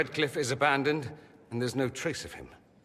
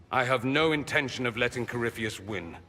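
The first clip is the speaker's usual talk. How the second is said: ordinary delivery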